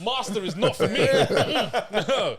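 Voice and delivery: laughing while turning it down. Real, not acted. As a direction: laugh through the verdict. Laughing verdict on the film.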